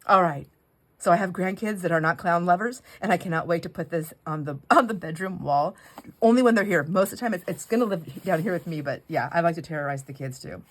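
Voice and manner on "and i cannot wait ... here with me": normal talking voice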